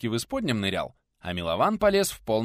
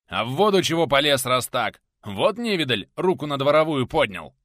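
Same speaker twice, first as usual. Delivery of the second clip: loud, raised-pitch rebuke; angry scolding; 5 semitones higher, 9 dB louder than usual. A narrator's reading. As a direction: tell him off loudly and indignantly.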